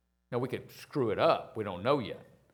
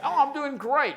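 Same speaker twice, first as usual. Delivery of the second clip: much higher, overdone cheery answer; fake cheerful reply; 14 semitones higher, 6 dB louder than usual. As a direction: play it up, all sunshine for the banker. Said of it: "you learn how to bullshit".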